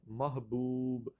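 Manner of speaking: محبوب slowly as mah-buub; correct pronunciation. Shown as a demonstration. Teacher repeats the right word, slow pronunciation. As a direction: stretch محبوب, opening with mah-. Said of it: "یہ ہمارا محبوب ہوتا ہے".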